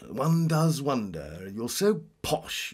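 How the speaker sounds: high, slow, pained upper-class drawl; Prince Charles impression. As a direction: play a stiff royal enunciating every word. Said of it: "You sound a little bit like Prince Charles".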